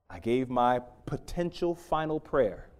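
usual speaking voice

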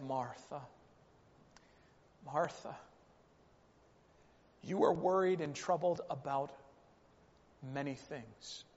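soft, low, tender reproof; very gentle. Retold or imitated. Jesus answers frustrated Martha in a very gentle voice. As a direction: say it gently and quietly, with space after each name. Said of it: "in a very gentle voice".

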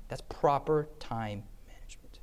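hushed whisper; soft whispered point; whispered aside. Real, not acted. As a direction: whisper it as a secret. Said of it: "whispered aside".

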